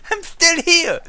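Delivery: high, loud sing-out to the startled character; playful high-pitched calling. Acted. Player teasing a startled game librarian, high loud playful call.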